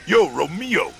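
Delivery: loud call to Romeo. Acted. Juliet hailing Romeo, calling out loud. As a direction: shout the name out like a hail. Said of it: "say your Juliet line".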